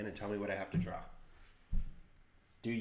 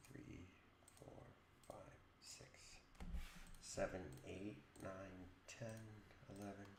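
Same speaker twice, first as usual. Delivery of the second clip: whispered count; 12 dB quieter than usual. Real, not acted. Counting again, mostly whispered.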